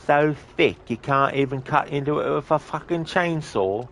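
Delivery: hammed-up British accent, mocking the game's voices; thick British accent. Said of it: "the British accent in Watch Dogs Legion is so thick".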